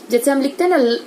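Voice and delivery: ordinary talk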